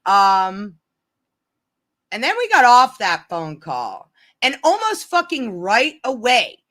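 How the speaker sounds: ordinary talk, nothing marked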